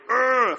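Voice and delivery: a short, loud, high growl of frustration; frustrated growl. Retold or imitated. Preacher mimics his surf rage to the congregation, mock frustrated growl. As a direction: let out an angry grunt. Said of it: "I usually save my swearing and my".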